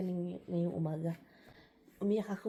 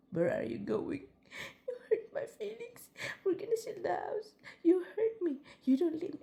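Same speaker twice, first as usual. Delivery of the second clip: mock wailing; 9 semitones higher than usual. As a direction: wail at the husband like a hurt child. Retold dream crying at husband. (pabirong umiiyak na pakiusap sa asawa)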